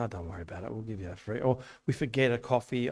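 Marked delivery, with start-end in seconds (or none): 0.0-1.4 lower, softer retold brush-off; casual reassurance. Cafe owner letting customers off paying, casually reassuring. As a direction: imitate waving off the bill in a low, soft voice.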